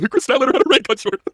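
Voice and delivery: lightly voiced whining fan; mock whine. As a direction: whine like an upset mark. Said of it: "fucking whine on social media".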